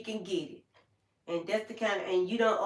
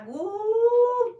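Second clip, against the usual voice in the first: excited whoop; 13 semitones higher, 7 dB louder than usual. Real, not acted. Working herself up about the lady.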